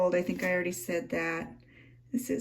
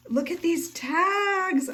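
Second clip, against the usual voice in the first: high, stretched-out delight; excited gushing; 10 semitones higher, 4 dB louder, slower than usual. Real, not acted. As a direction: squeal it slowly over a lovely gift. Delight at handmade tags.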